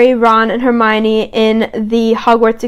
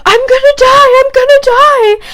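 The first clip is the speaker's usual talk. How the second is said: much higher, hammed-up wail of fake panic; panicked whine; 4 dB louder than usual. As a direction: play the dramatic crybaby, shrieking. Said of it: "and he's like".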